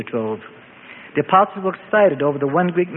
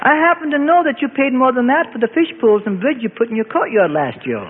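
higher, knowing accusation; suspicious; 8 semitones higher than usual. A narrator's reading. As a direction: call out his bluff with a squint. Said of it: "squinting at the new believer".

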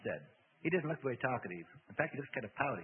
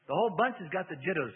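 loud, raised disgust; 6 semitones higher, 9 dB louder than usual. A narrator's reading. Demon scorns the whole bunch, disgusted. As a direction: throw it out with disgust. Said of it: "said Reuben with disgust".

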